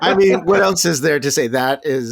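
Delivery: loud, high, laughing amazement; excited. Real, not acted. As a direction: burst out in real delight. Excited reaction continues.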